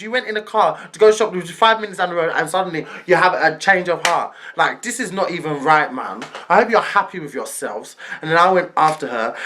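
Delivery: ordinary talk, nothing marked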